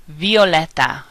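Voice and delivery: Spanish "Violeta", V softened toward B; Spanish soft V. Shown as a demonstration. Teacher modelling V words, soft V in "Violeta". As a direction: say "Violeta" as "byo-LEH-ta". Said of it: "V sounds more like the letter B".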